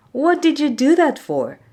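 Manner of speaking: shocked at what he just did; alarmed. Acted. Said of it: "asked in alarm".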